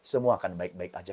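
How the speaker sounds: calm the little one down gently; soothing mother. Acted. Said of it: "ketika mama peluk".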